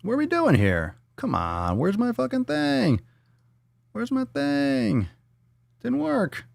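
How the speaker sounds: high, whiny mock dismay; squeaky put-on frustration; mock fretting. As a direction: whine playfully about your missing gadget. Mock fretting over missing soundboard clip.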